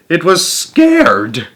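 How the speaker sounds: drawn out slowly; slow, hushed. A narrator's reading. Narrator slows down as the beetle freezes in fear. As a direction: let the fear sink in.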